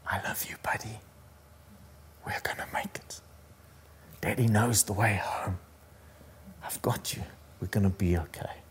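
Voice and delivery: soft unvoiced whisper to a frightened child. Father comforting his scared son in the storm, whispered. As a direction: breathe the words close to his ear. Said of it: "he just whispers".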